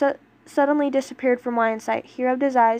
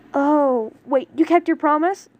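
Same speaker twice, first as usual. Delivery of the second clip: surprised; 4 semitones higher, 5 dB louder than usual. Acted. Touched surprise at promise.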